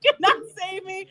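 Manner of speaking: high-pitched reply broken by laughter; laughing high. Real, not acted. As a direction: laugh through the words, voice way up.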